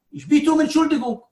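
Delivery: high, urgent plea for pardon; acted impassioned apology; pleading apology.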